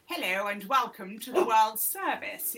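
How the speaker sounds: mock received pronunciation newsreader; BBC announcer. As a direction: mimic a formal old World Service announcer. Imitating old BBC announcer.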